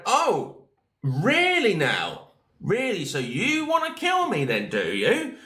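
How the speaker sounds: mock-incredulous challenge to a threat; sarcastic disbelief. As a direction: pitch leaps high on so you wanna kill me, then drops.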